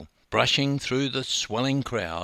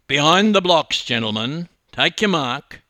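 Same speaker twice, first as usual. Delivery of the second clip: loud, raised race starter's call; 4 semitones higher, 7 dB louder than usual. A narrator's reading. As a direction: call the swimmers to their marks. Starter calling swimmers to the blocks.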